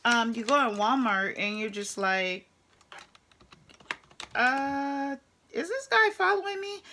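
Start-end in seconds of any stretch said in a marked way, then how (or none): 4.3-6.8 much higher, wary and startled; alarmed thought. Retold or imitated. Woman in Walmart wondering if a man follows her, high alarmed. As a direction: squeak it out suspiciously.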